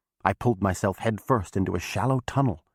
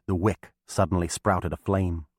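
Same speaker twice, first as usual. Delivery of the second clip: ordinary delivery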